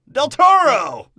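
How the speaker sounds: high, loud announcing shout; triumphant shout. Retold or imitated. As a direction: call the name out like a game-show reveal. Disney announcing its casting pick.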